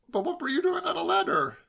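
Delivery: high, insistent question; pestering nephew. A narrator's reading. Nephew pressing uncle about the ladder, pestering. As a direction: keep at it in a high voice.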